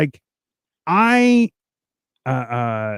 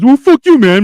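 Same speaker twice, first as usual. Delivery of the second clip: higher, louder heckler shout; mock heckler yell; 9 semitones higher, 9 dB louder than usual. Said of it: "in an audience was says".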